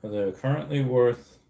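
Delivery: ordinary talk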